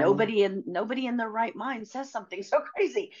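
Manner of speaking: wife telling the host how crazy it sounded, exasperated; exasperated disbelief. Real, not acted. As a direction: still stunned by how absurd it was. Exasperated retelling, laugh.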